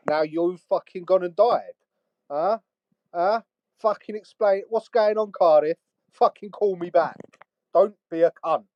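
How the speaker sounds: high, loud furious voicemail rant; yelling at a friend's voicemail; angry rant. Acted. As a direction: shout it down the phone in disbelief.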